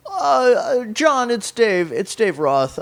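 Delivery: high, drawn-out sing-song phone greeting. Retold or imitated. Friend imitating the singer calling him up, high sing-song impression. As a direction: imitate a singer who talks as if singing, starting high. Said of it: "that's how his voice sounds".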